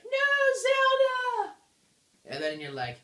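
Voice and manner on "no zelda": very high, loud wailing cry; hammed-up falsetto cry of despair; Midna. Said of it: "And then Midna's like".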